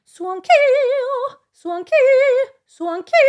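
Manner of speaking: "Suanki" hit at the start then backed off; accent the start of "Suanki", stay light. Shown as a demonstration. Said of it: "accent the beginning and then back off".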